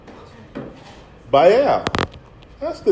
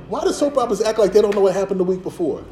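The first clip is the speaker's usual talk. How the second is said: ordinary talk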